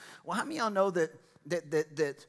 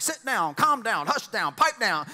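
high, loud parent's orders; nagging parent; 6 semitones higher, 7 dB louder than usual. Retold or imitated. Preacher imitating parents to the congregation, nagging. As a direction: scold like a fed-up parent.

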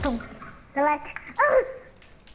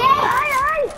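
pained yelp; 10 semitones higher, 7 dB louder than usual. Pretend. Kid hit in pretend game.

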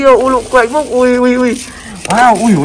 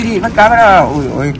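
ordinary talk, nothing marked

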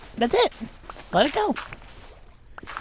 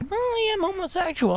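high, soft mock-agreeable guy; light, high put-on voice; imitated cheerful admission; 10 semitones higher, 5 dB quieter than usual. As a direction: play him breezily owning up. Voices the other guy's cheerful admission.

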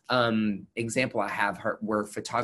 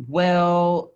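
loud, high, drawn-out "well"; stretched-out mock hesitation, pitched up; mock doctor; 8 semitones higher, 9 dB louder than usual. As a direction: imitate a dismissive doctor hedging loudly. Imitating a doctor's dismissive remark.

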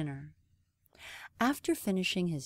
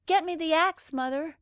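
higher, urgent call to mother; cried out; 8 semitones higher, 5 dB louder than usual. Acted. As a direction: shout down in a hurry. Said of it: "cried Jack".

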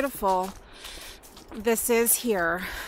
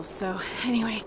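whispering; 7 dB quieter than usual. Real, not acted. Aside while filming a walk.

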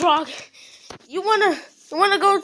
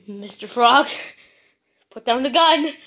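ordinary talk

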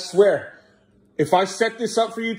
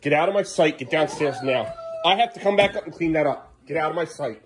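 usual speaking voice

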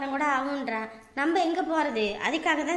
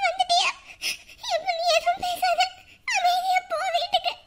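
very high, crying voice; tearful pleading; 12 semitones higher than usual. Acted. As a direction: beg through sobs. Crying sister sends brother home.

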